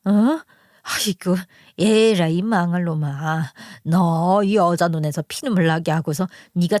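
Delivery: put-on older mother-in-law lamenting, then cursing her son; distraught scolding mother-in-law. Mother-in-law scolds son over the weeping wife, outraged. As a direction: wail "aigo" in character, then scold.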